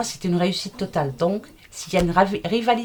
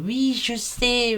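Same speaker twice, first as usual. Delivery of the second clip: ordinary delivery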